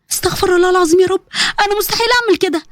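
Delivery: put-on high, distressed girl's voice; distressed refusal. Girl to her blackmailer's demand, high and distressed.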